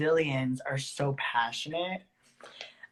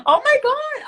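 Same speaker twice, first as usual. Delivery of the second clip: much higher and louder, real delighted outburst; excited squeal; 10 dB louder than usual. Real, not acted. Finding out they share a zodiac neighbour, squealing with delight. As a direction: burst out in thrilled surprise. Learns a fellow star sign.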